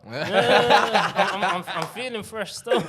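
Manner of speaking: high, loud laughing reply. Real, not acted. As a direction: laugh through the words. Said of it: "You look fresh, huh?".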